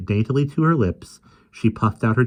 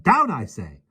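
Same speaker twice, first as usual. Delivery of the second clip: stern command; 7 semitones higher, 4 dB louder, slower than usual. A narrator's reading. Mary commanding the lion.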